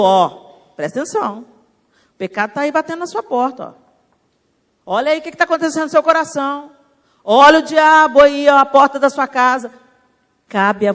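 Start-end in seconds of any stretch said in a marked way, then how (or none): 0.0-9.7 God warning. Acted. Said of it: "Aí Deus já falou".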